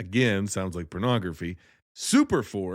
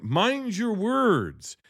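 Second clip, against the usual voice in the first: drawn-out, much higher "mind your words"; slow warning; 10 semitones higher, much slower than usual. Pretend. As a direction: stretch each word out as a mock warning. Mock warning to Kirsten.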